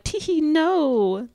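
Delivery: high, drawn-out fake titter; hammed-up teasing tee-hee; mock coy giggling. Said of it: "But they're like".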